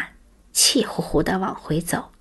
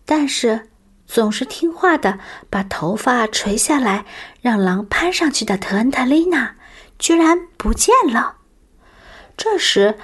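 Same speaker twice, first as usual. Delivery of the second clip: ordinary delivery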